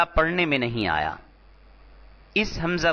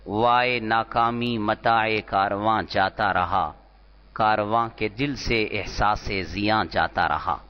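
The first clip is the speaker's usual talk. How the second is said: recited Urdu verse; 5 semitones lower than usual. Shown as a demonstration. Teacher reciting a poet's verse to class, measured and lower. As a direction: recite the couplet with feeling. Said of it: "وہ شاعر کا شعر ہے".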